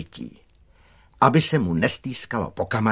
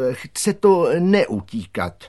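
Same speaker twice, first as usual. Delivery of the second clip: higher, slowed, pointed advice; admonishing; 7 semitones higher, 3 dB louder, slower than usual. A narrator's reading. Director advises the gardener not to run, admonishingly. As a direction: spell it out as a gentle lesson. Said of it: "dodal nabádavě".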